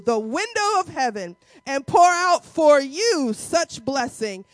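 slow, loud, preaching cadence; booming pulpit reading; fervent scripture reading. Acted. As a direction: proclaim the Lord's words with full force. Reads scripture with enthusiasm.

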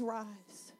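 low, hushed single word; Jesus softly commanding the man; commanding rise. Acted. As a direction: drop the voice and command it. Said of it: "he says".

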